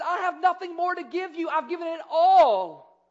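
emphatic despair. Acted. Isaac to his son with no blessing left, emphatic despair. Isaac tells Esau nothing remains.

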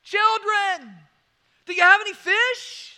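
calling out loud and high across the water; yelled out. Retold or imitated. Jesus calling to fishermen from the shore, loud call. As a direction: shout across the water to the boat. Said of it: "He yelled out to them".

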